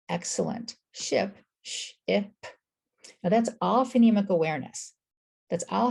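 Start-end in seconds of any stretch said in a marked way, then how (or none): 0.9-2.5 sh, I, p as separate sounds; sounded out. Shown as a demonstration. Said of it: "What are the sounds?".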